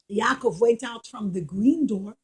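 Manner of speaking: usual speaking voice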